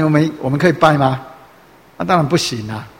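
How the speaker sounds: ordinary talk, nothing marked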